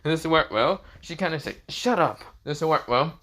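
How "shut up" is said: higher-pitched bashful retort; flustered, blushing. A narrator's reading. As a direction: brush it off, embarrassed. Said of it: "Junko blushes".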